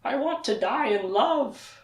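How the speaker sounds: high, slow, breathy-soft plea; dying Char. Retold or imitated. Recapping the show, speaker voices Char begging Kaylin softly. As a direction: softly beg like a dying spirit. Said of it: "and Char's like".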